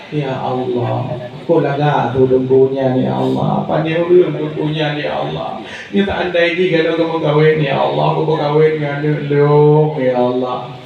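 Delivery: low, pleading-style prayer; acted prayer. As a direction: play someone praying, pitched well down. (doa yang diperagakan dengan suara rendah)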